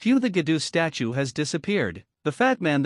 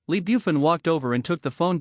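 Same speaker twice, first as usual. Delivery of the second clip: ordinary delivery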